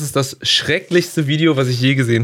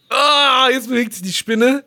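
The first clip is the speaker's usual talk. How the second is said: high panicked squeal; shrieking in disgust; 12 semitones higher than usual. Real, not acted. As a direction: squeal as the spider moves. Spider moves in horror video.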